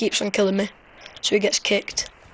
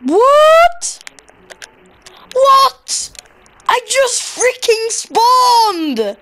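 shrill shocked yelps, much higher and louder, last line dragged out slowly; outraged disbelief; 13 semitones higher, 6 dB louder, much slower than usual. Real, not acted. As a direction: cry out in disbelief at being killed on spawn. Just killed on spawning.

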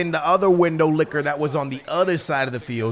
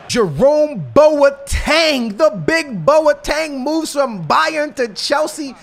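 drawn-out amazed announcement; 6 semitones higher, 3 dB louder, much slower than usual. Real, not acted. Transfer news shocks the player.

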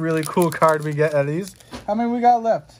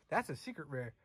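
quiet; 5 semitones lower, 18 dB quieter than usual. Real, not acted.

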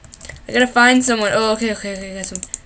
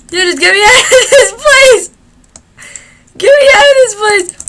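laughing yell, much higher and louder; shocked laughter; 9 semitones higher, 6 dB louder than usual. Real, not acted. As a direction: shout through laughter in panic. Trapped, laughing and yelling.

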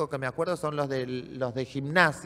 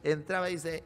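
ordinary talk, nothing marked

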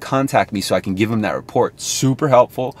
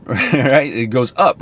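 usual speaking voice

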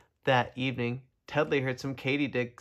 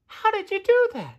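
squeaky high amazed voice; tiny bug, amazed and loud; high firefly voice; 6 dB louder than usual. Acted. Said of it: "he asked".

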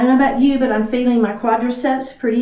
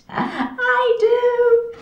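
much higher, drawn-out "I do"; high-pitched admission; 12 semitones higher than usual. Real, not acted. Instructor admitting to her class that she feels it, high-pitched. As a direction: sing it out high, owning up to the strain.

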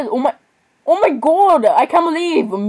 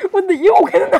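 high breathless laughter through the words; laughing fit; 7 semitones higher than usual. Real, not acted. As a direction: crack up mid-sentence.

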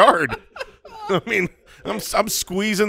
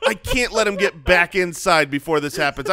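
usual speaking voice

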